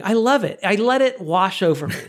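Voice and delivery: ordinary talk, nothing marked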